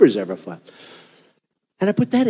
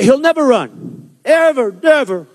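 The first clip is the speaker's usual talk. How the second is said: slow, higher, sure-of-itself pundit voice; mock confident; 5 semitones higher, slower than usual. Pundits smugly predicting he'll never run, mock confident. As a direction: drawl it out like a smug pundit who knows he won't run. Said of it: "with such confidence".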